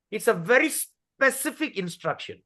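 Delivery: normal talking voice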